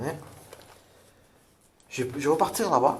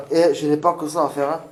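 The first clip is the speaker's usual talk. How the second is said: loud, higher challenging call; 3 semitones higher, 8 dB louder than usual. Real, not acted. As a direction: project it to the empty room. Calling out loud to spirits.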